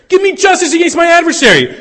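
high, loud cry of the words Give me justice; screamed plea. Acted. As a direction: shriek it through the night. Widow shouting at night.

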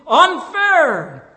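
a retold complaint, loud and sharp; protesting cry. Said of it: "and I complained".

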